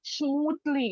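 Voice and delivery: very slow, drawn-out sentence; slow dictation-style read; slow example. Shown as a demonstration. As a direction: spell the example out slowly for the class. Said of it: "The mixer runs smoothly".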